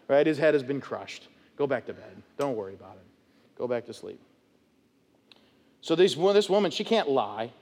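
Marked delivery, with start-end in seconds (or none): 1.6-4.2 much softer and lower, shrugging it off; offhand lines to himself about Satan, sleepy and dismissive. Retold or imitated. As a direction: murmur it like you're already rolling over. Leader shrugs off Satan at night.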